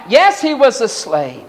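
normal talking voice

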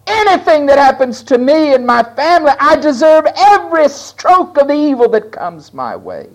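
high, loud preaching climb; full-force sermon cry; fiery preaching. Acted. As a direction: hammer it home from the pulpit.

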